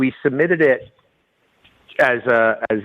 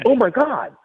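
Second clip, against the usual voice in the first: high, stunned oh my God; amazed; 11 semitones higher, slower than usual. Retold or imitated. Hearing the rum won top rating, stunned amazement. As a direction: gasp it out in disbelief. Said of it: "And we were like".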